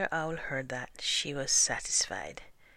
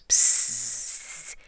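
long, loud hissing buzz; mosquito whine; 4 semitones lower, 7 dB louder than usual. A narrator's reading. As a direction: sibilant insect noise. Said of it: "whining in people's ears".

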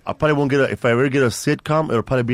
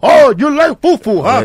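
high, loud, slow teasing voice; mock Nigerian uncle; 13 semitones higher, 10 dB louder, slower than usual. Teasing a fufu lover, hammed-up high voice. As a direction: ham it up, much higher and louder.

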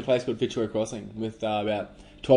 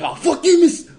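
much higher, louder Kiwi schoolboy yelling at his teacher; hammed-up Kiwi kid shouting back, pitched way up; Kiwi accent; 9 dB louder than usual. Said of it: "that's Kiwi too".

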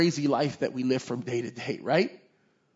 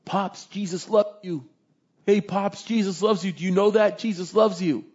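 higher, earnest pleading son; gently urging a dying father; son pleading with dad; 6 semitones higher, 4 dB louder than usual. Retold or imitated. Son urging his dying dad at the hospital bedside, higher and pleading.

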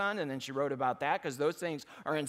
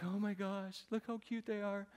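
higher, softer gushing voice in wife's manner; imitating wife; 4 semitones higher, 7 dB quieter than usual. Husband mimics wife cooing over baby finches, higher and softer. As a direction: coo it like his wife melting over baby birds. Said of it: "She's like".

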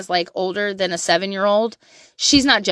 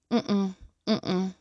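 normal talking voice

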